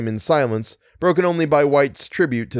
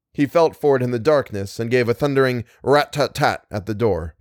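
ordinary delivery, nothing marked

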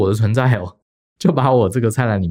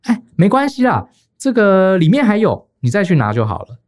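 higher, light, breezy auntie brushing it off; smiling, breezy; 9 semitones higher, 4 dB louder than usual. Retold or imitated. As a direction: chirp it off like taking it is nothing. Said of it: "她就笑笑地说".

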